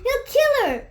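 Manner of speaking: high, loud cry of alarm; panicked scream. Acted. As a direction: shriek it out in panic. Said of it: "screamed the Sultan".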